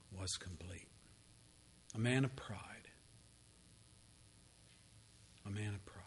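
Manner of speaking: whispered, solemn lament; grave whisper of pride's fall; hushed whisper. Real, not acted. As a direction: let it sink in, barely audible.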